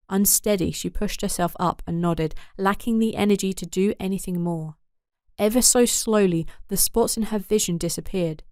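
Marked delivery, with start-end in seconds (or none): none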